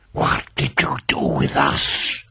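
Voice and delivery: low, whispered spectral hiss; strange hissing voice. A narrator's reading. Dead victims questioning their killer, hissing. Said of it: "hissing voice again".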